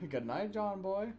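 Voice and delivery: high, slow, drawn-out sing-song goodnight; mock Waltons goodnight. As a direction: stretch it like a TV-family farewell. Waltons-style sign-off goodnight.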